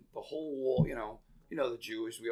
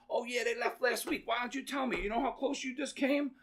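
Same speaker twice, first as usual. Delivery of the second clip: much higher-pitched, worked-up impersonation; agitated gang boss; 11 semitones higher than usual. Retold or imitated. Gang boss confronts bank worker in backyard, agitated high-pitched retelling. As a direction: act out the indignant challenge in a raised pitch.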